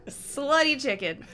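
slow words through real laughter; laughing while saying it. Real, not acted. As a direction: giggle it out.

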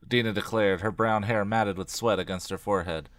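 usual speaking voice

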